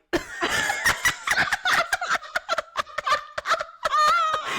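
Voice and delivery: high helpless shriek of laughter. Real, not acted. Mum retelling her daughter's question to Santa, shrieking with laughter. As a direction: crack up until the laugh turns to a squeal.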